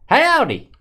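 loud, high-pitched holler; yelled howdy. Shown as a demonstration. Host shows Zach how he says it, yelled howdy. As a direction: yell it, high then dropping. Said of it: "I yell howdy".

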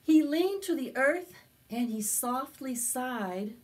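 put-on slow, lively read-aloud for children; storybook narration. A narrator's reading. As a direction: tell it to a circle of little kids. Storytelling continues.

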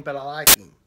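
normal talking voice

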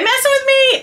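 high, fast disbelieving repeat. Real, not acted. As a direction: jump way up in pitch, unable to believe it. Unboxing surprise gift.

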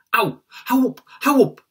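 high, loud yelp of protest; pained shout. Acted. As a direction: yell at someone hitting you to stop. Brother pounding his arm.

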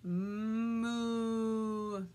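long, drawn-out cow imitation; cow's moo. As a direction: give a steady storytime moo. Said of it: "said Cow".

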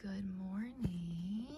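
slow, low, whispery good morning; hushed greeting. Real, not acted. Owner greeting the sleepy puppies, hushed. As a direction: greet sleepers softly and slowly.

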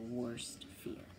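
breathy whispered word. A narrator's reading. Narrator whispers the last word for suspense. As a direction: drop to a hushed whisper. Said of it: "narrator whispers for suspense".

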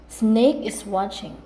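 ordinary delivery

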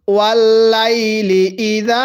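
slow, long vowels on লাইলি ইদা; Quran-reading drawl on ওয়াল লাইলি ইদা; drawn-out recitation. Shown as a demonstration. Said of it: "টান দিব".

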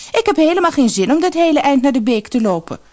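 loud, high-pitched whining protest; petulant refusal. A narrator's reading. Spoiled daughter refusing to walk to the brook, petulant. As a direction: complain loudly like a spoiled girl. Sister complains about the walk.

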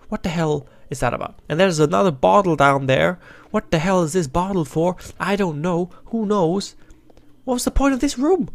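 ordinary talk, nothing marked

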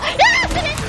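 high loud insistence; insistent shout. Real, not acted. Gamer answers his own doubt with a loud shout. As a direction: snap back at your own doubt. Arguing with himself.

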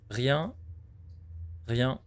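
ordinary delivery

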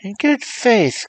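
high, slow exclaiming mother; a mother crying out in high excitement. A narrator's reading. Narrator voicing the mother, high and slow. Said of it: "cried the mother".

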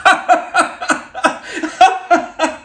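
bright high laugh. Real, not acted. Vlogger laughing at her own joke, high laugh.

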